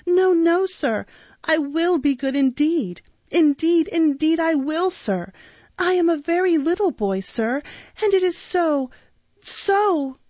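put-on high-pitched pleading little boy; sobbing plea. A narrator's reading. Frightened boy promising to be good, acted sobbing plea. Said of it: "sobbed Oliver".

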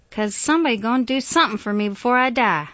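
impassioned, forceful appeal; insistent demand. A narrator's reading. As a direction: demand it as a last wish. Miss Emma demands a last favour.

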